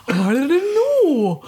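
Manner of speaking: loud, high, stretched-out creature voice; hammed-up wavering octopus. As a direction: wail it slowly like an octopus underwater. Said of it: "what would an octopus sound like?".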